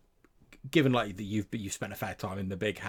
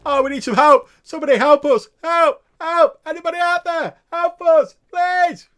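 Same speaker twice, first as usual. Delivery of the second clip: high, loud, whimpering cries for help; desperate pleading; 15 dB louder than usual. Acted. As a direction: beg like starving prisoners stuck for days. GM voices starving prisoners pleading.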